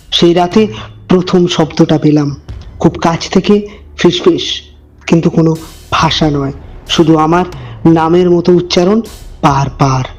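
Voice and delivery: low, hushed storyteller voice; eerie narration, measured and dark; horror story narration. A narrator's reading. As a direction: draw the listener into a creepy tale.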